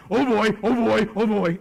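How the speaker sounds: a hint of an overjoyed dog; excited dog. Dog greeting owner after a crappy day, excited. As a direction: voice an eager dog, loud and repetitive. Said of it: "my dog is like".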